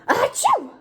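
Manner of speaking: high, loud explosive achoo; big sneeze. Acted. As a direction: sneeze hard. Gerald sneezes.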